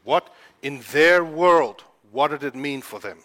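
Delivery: slow emphatic question; slow emphasis. Real, not acted. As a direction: lean on each word slowly. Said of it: "slow emphatic question".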